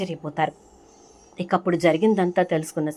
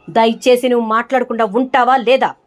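loud, higher angry command; shouting to silence; 5 semitones higher, 9 dB louder than usual. Acted. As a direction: yell at her to shut up. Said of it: "పారిజాతం గట్టిగా అరిచేసరికి". (గట్టిగా అరుస్తూ నోరు మూయించడం)

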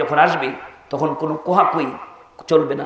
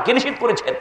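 usual speaking voice

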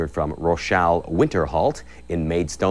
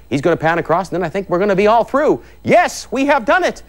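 building triumphant excitement; 13 semitones higher, 3 dB louder than usual. Real, not acted. Host cheering the cameraman to the finish, triumphant excitement. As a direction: pitch climbing into a high cheer.

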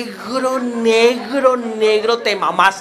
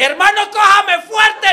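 grito agudo y lento; grito retomado; 11 semitones higher than usual. Retold or imitated. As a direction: gritarle al amigo mientras resbala. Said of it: "Yo le grité". (grito agudo y lento al amigo con la cama)

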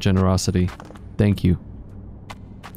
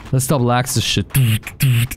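ordinary talk, nothing marked

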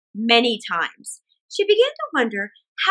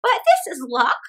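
put-on chatty old woman thinking aloud; cheerful old woman. Old woman muses over the pot in the ditch.

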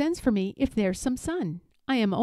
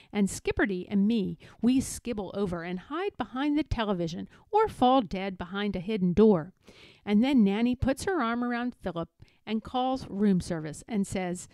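ordinary delivery, nothing marked

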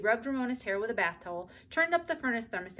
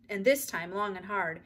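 ordinary delivery

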